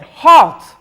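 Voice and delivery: loud, high command; commanding shout. A narrator's reading. Shakra orders the fleeing people to stop, loud command. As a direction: stop the crowd with one word.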